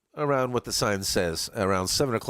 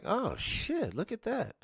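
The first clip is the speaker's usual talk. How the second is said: mock surprise; 14 semitones higher, 5 dB quieter, slower than usual. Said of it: "be like".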